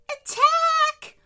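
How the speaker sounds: very high, loud bird wail; wailed battle cry. A narrator's reading. Frogmouth leaping at Mia, wailing loudly. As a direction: wail the order to charge. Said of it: "wailed a wide-mouthed bird".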